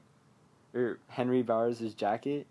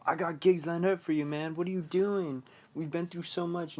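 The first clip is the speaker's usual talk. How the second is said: retold manager; 5 semitones higher than usual. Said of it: "His manager's all like".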